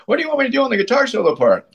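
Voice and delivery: high-pitched, hurried mock question; rapid high-voiced imitation of a bandmate; imitating bandmate's question.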